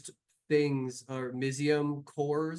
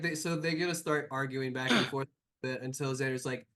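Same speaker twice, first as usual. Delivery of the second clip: ordinary delivery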